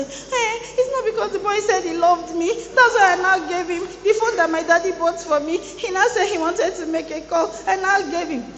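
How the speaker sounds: put-on high, whiny girl's excuse; imitating naive girl. Preacher mimics a girl's excuse, high whiny voice. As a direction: play the naive schoolgirl pleading, high. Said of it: "Just say".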